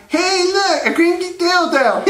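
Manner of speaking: very high squeaky nerd voice; high-pitched nerd impression; Steve Urkel. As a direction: point it out as a gleeful sitcom nerd. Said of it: "Urkel kinda like".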